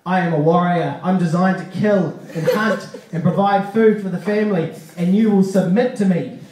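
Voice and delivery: loud chest-beating warrior proclamation; warrior boast. Retold or imitated. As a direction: declare it like a caveman chieftain. Wife hears warrior declaration.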